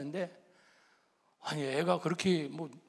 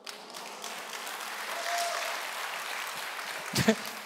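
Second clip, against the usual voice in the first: long high breathless laugh; real laugh; 4 dB quieter than usual. Real, not acted. Pastor hearing son wants to be pastor, laughing.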